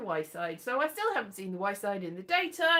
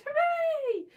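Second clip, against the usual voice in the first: high, drawn-out hooray; celebratory cheer; 4 dB louder than usual. Real, not acted. Celebrating a part in a radio play, high happy cheer.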